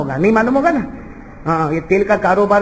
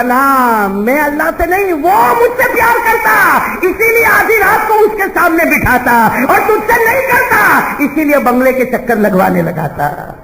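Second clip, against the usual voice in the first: high, loud, slow defiant reply; bold retort; 8 semitones higher, 4 dB louder than usual. Acted. Slave girl standing up to her master, loud and defiant. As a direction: stand up and answer him proudly.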